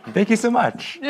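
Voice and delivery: thanks through laughter; laughing. Real, not acted. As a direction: laugh as you say it. Speaker laughing while thanking.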